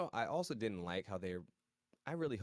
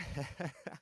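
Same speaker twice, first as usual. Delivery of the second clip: short laugh; 7 semitones higher than usual. Real, not acted. Laughing about pharmacist shout-out.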